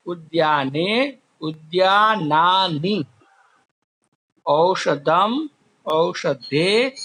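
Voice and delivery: forms recited slowly, one by one; teacher reviewing noun forms; slow recitation drill. Shown as a demonstration. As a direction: chant the word forms slowly for students. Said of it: "फिर से एक बार ये आपको समझा दूं".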